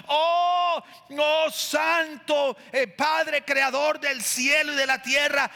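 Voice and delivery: high, drawn-out pompous praying voice; mock grandiose prayer. Preacher mocking someone praying pompously. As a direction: overblown churchy prayer, hammed up. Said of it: "si tú oyes a alguien orar así".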